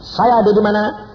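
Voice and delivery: high, loud cry of conviction; bold declaration. Retold or imitated. Retold bold declaration of faith. (seruan yakin yang lantang)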